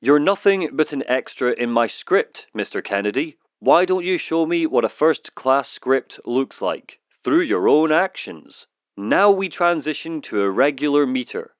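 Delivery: ordinary delivery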